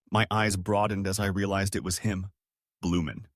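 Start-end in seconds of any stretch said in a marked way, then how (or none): none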